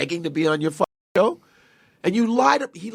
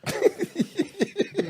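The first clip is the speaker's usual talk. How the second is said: high laugh. Real, not acted. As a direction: burst of high-pitched laughter. Laughing at the absurd claim.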